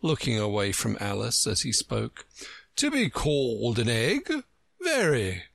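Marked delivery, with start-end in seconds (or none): 2.8-5.4 Humpty. A narrator's reading. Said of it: "Humpty Dumpty said".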